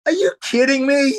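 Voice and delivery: high, disbelieving head-shake question; incredulous Tom Hanks. Retold or imitated. Tom Hanks to late guest, high incredulous mock. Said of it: "He's like".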